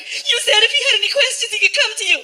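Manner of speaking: very high mock-weepy voice; high-pitched tearful reproach; tearful girlfriend. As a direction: whine it as if in tears. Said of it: "Tears, right?".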